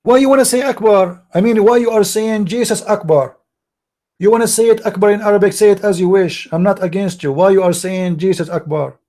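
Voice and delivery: high, forceful, exasperated challenge; heated remonstrating. Real, not acted. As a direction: take them to task, pitch well up. Arguing heatedly over the word Akbar.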